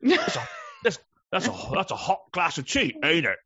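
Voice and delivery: high, hammed-up British accent; mock British accent. Co-host imitating British conversational habits, mock British accent. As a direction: exaggerate a Brit remarking on tea. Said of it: "the British do".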